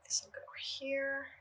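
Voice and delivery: soft unvoiced whisper to herself. Real, not acted. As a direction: whisper it under your breath. Murmurs to herself while working.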